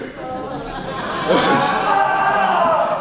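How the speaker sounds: high drawn-out laugh. Real, not acted. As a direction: let a long high laugh out. Laughs after villain quote.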